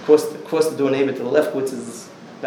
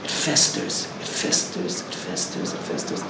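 fading whisper; 7 semitones lower, 4 dB quieter, slower than usual. Acted. Repeating, fading away.

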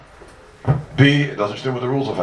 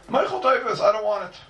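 acted refusal; 11 semitones higher than usual. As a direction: act out a guy turning down a gift, voice jumping up. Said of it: "Says the guy to the Eibishter".

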